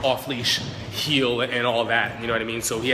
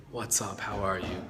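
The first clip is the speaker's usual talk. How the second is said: much softer, lower easy hello; calm greeting; 5 semitones lower, 10 dB quieter than usual. Shown as a demonstration. Trainer showing the calm way to greet a dog, soft and easy. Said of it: "I'm gonna be calmer".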